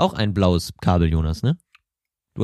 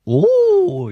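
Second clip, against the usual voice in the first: an exaggerated high oh of amazement; mock amazed oh; 4 dB louder than usual. Jonas gets teased with a squealed oh of wonder. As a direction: squeal oh as Jonas would think it. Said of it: "Jonas denkt sich auch".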